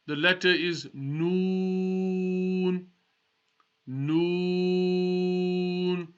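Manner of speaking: Noon drawn out slowly, not through the nose; slow Arabic Noon; Noon not nasal. Shown as a demonstration. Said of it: "should not go into your nose".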